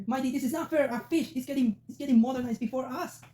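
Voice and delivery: squeaky, high-pitched indignant voice; high squeaky outrage. Pretend. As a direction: whine it in a high cartoon squeak. Mock protest to Mighty.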